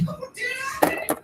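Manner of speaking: very high, soft sarcastic coo; mocking baby talk. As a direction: feign concern in a baby-talk voice. Sarcastic retort to dog owner.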